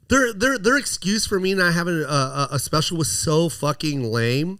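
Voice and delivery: ordinary talk, nothing marked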